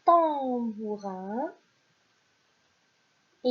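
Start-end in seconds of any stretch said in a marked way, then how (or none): 0.0-1.5 "tambourin" drawn out very slowly, every syllable clear; slow dictation. Shown as a demonstration. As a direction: stretch "tambourin" for the class. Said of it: "c'est un".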